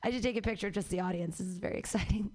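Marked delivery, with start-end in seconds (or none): 1.4-2.3 hushed laughing aside; whisper. Real, not acted. To the packed room, a laughing whispered aside. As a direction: whisper it like a secret.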